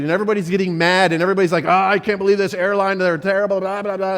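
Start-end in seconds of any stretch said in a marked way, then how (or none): 1.7-4.2 mock grumbling, pitched higher; frustrated travellers. Preacher mimics angry airport crowd, mock grumbling. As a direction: imitate annoyed passengers complaining. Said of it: "everybody's frustrated".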